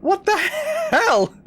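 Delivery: high, drawn-out disbelieving shout; incredulous shout. Retold or imitated. Retelling the stranger's rant to chat, shouted disbelief. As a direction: yell it in shocked disbelief. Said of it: "I was like".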